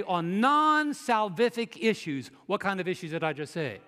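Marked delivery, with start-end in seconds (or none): 0.0-2.3 "non-salvific" slow and higher, stretched on NON; clear emphatic wording. Shown as a demonstration. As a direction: spell out "non-salvific" with deliberate force. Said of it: "let me say it clearly".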